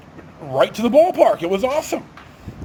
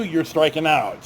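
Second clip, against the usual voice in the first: ordinary talk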